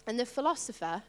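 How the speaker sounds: usual speaking voice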